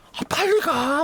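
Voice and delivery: very high, strained mock urging; urgent prodding. Cop urging his companion into the building, frantic. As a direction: imitate someone frantically pushing a partner ahead.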